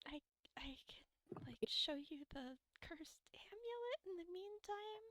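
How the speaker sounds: hushed, secretive whisper; whispered aside. Acted. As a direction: murmur it so James won't hear. Luke offers cursed amulet.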